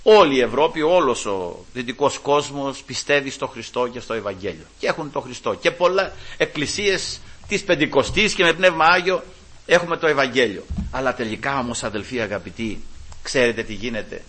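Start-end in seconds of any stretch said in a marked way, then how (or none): none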